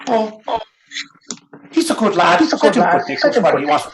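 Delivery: a hint of a fond mother; doting mother. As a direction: stand up for your lad gently. Mother voiced defending son.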